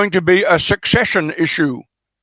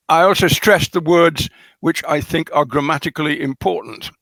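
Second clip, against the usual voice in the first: ordinary talk, nothing marked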